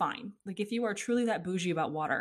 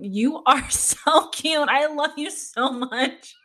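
slow, laughing, higher gush of affection; 8 semitones higher, 7 dB louder, much slower than usual. Retold or imitated. Wife answering her cleaning lady's text, laughing gush. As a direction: laugh through the words, gushing. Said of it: "I'm like".

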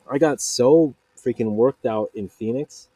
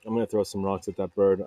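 normal talking voice

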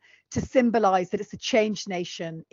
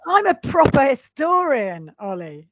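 mock pompous; 5 semitones higher, 5 dB louder than usual. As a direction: puff yourself up ironically. Mock-pompous self-praise.

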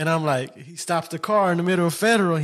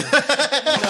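short high-pitched burst of laughter; a quick high cackle; 10 semitones higher than usual. Real, not acted. Telling co-host the slushie story, high laugh.

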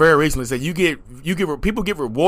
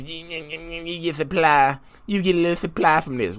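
soft, whiny mock voice; mocking critics; 3 dB quieter than usual. Imitating critics who grumble about supply, mocking mutter. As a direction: mimic a petty complaint under your breath.

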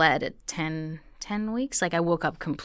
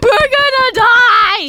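much higher, louder, slow panic; panicked wail; 13 dB louder, slower than usual. Retold or imitated. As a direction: wail it in terror. Retold panic on plane.

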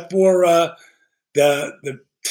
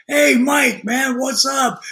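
much higher, eager hello down the line; cheerful phone hello; 7 semitones higher, slower than usual. Retold or imitated. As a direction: greet an old friend happily, pitched high. Answering friend's phone call.

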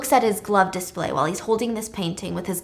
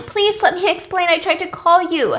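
mild mock crying; 8 semitones higher, 3 dB louder than usual. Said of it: "she's crying".

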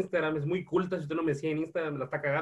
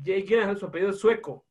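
ordinary talk

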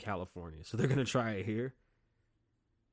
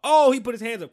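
much higher, loud accusing shout; mock cop shouting; 11 semitones higher, 14 dB louder than usual. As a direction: shout it like a cop twisting things. Acted cop during traffic stop.

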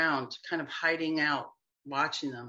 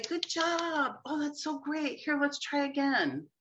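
put-on higher, bright praise for a toddler who fell; encouraging parent; 10 semitones higher than usual. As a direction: cheer on a toddler learning to walk. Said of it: "You say".

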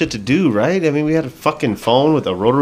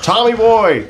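higher mild shout of the nickname; mock yell; 10 semitones higher, 3 dB louder, slower than usual. Students taunting a teacher with his nickname, mild mock yell. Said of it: "yelling out".

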